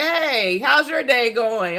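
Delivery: high, slow friendly hello; cheerful greeting. Shown as a demonstration. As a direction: greet them brightly and slowly. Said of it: "We say".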